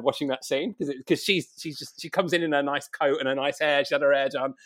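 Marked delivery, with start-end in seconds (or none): none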